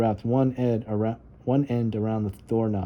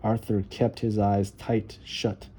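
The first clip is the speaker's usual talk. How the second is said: usual speaking voice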